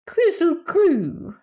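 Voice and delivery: very high, loud, slow cat voice; a cat yowling the word to anger the Woozy; glass cat's call. A narrator's reading. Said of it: "And the glass cat said".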